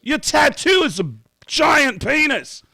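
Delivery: high, loud put-on voice for the rock singer. Retelling how the singer greets the naked band, in a high loud voice. [name] voiced in retold story.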